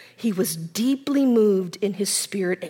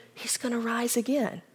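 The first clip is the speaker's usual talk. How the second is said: gentle; 8 dB quieter than usual. Acted. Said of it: "He's gentle".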